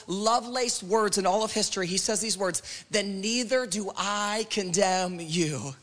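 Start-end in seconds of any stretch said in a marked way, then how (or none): 2.9-5.8 lingering, measured delivery; slow, weighty quote. Acted. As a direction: let each word land slowly. Weighty first reading of Jesus's words.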